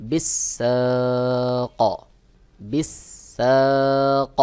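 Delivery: বিসাক drawn out very slowly, the final ক bounced with a hint of zabar; Quranic recitation demo. Shown as a demonstration. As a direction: recite বিসাক slowly, the ক echoing open-mouthed. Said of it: "সহি উচ্চারণ হবে". (বিসাক ধীরে, শেষের ক-তে জবরের আভাসে কলকলা)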